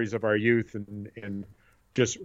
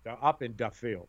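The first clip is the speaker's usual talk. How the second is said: put-on light Polish accent, dropping to a softer close; mother's Polish accent; 7 dB quieter than usual. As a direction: repeat it in the Polish accent, letting it fall softer. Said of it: "in a bit of a Polish accent".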